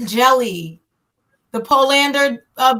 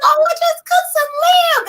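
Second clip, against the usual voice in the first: much higher, airy mock-cheerful friend voice; mimicking the friend. Friend announcing the lamb, high mock-breezy voice. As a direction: play the breezy hostess, pitched way up.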